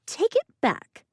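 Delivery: very high squeaky little creature. A narrator's reading. Colby's squeaky voice.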